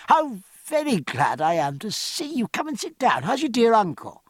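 high-pitched, delighted welcome; open pleasure. A narrator's reading. Jack welcoming the French lieutenant he knows, openly delighted. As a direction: greet them with lifted, glad surprise. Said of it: "changing to open pleasure".

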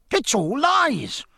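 beside himself, hurl the denial; indignant cry. Acted. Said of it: "cried Rogers, beside himself with indignation".

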